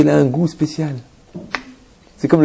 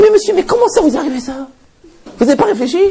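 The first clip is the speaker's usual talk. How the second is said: much higher, shocked scolding question; incredulous reproach; 13 semitones higher than usual. Retold or imitated. Rabbi retelling how he scolded the adulterous man, incredulous. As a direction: quote your own stunned reproach.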